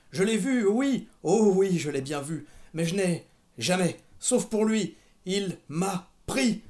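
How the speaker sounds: loud, high, halting outburst; feverish self-talk. A narrator's reading. Mother raving to herself, loud halting delirium. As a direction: relive it aloud, dragging out key words. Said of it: "Elle semblait se parler à elle-même".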